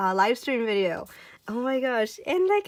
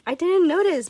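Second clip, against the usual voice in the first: high, loud excited discovery; surprised delight; 8 semitones higher, 8 dB louder than usual. Real, not acted. Fan spotting the gold-pen signature, surprised delight.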